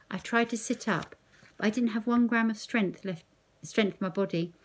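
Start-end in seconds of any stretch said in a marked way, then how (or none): none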